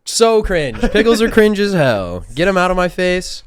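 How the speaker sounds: loud, high-pitched outburst of disapproval; high, forceful comic condemnation; emphatic disgusted verdict. Real, not acted. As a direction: reject it loudly and emphatically. Emphatic verdict against pickles.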